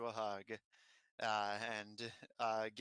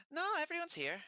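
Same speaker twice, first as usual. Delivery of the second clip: high-pitched character voice; Dimitri's greeting; faster than usual. Acted. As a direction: answer in a much higher voice.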